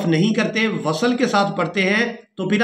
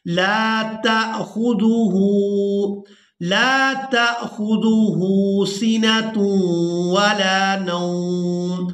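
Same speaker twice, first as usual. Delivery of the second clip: slow, melodic tilawat, drawn out; Quran recitation; 2 semitones higher, much slower than usual. Shown as a demonstration. Teacher reciting the verse to students, slow sung tilawat. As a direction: chant each word long and sung.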